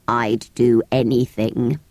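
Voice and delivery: low, slow, tearful creature. A narrator's reading. Creature moved by the girl's promise, low and tearful. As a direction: draw it out low and sorrowful. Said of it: "it said in a tearful voice".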